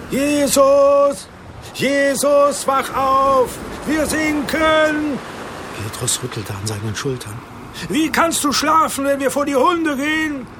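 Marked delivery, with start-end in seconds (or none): 0.1-5.2 desperate cry for help; frantic shouting. Acted. Disciples wake Jesus in storm. 7.9-10.5 high, panicked pleading; desperate accusing cry; frightened reproach. Acted. Petrus shaking Jesus, panicking.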